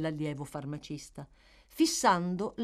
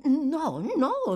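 much higher, louder quick reassurance; eager denial; 10 semitones higher, 7 dB louder than usual. Acted. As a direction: hurry her on eagerly. Matron eager to hear.

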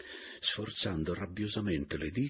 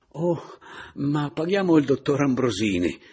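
loud, higher, as if suddenly remembering; esclamazione improvvisa; 7 semitones higher, 9 dB louder than usual. Acted. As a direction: feign a sudden recollection, loudly. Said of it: "esclamai fingendo di ricordarmi improvvisamente". (esclamando come per un ricordo improvviso)

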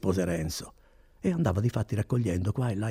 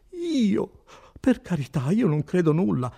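higher-pitched protest of an innkeeper; protesting innocence; 8 semitones higher, 4 dB louder than usual. Acted. Innkeeper disclaims all knowledge to the notary, protesting. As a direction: plead ignorance, voice jumping up.

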